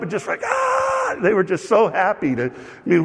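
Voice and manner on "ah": excited cheer. Retold or imitated. Crowd rising in applause.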